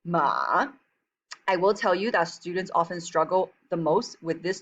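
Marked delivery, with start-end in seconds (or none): 0.0-0.7 long "Ma" dipping low, then rising; drawn-out dip and rise on "Ma"; third tone. Shown as a demonstration. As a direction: start "Ma" low, sink lower, swing back up. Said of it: "The third tone is a low dipping tone".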